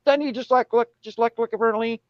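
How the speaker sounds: imitating the aunt gushing to mom; aunt's high-pitched babble trailing into blah blah; excited aunt. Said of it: "to my mom, she says".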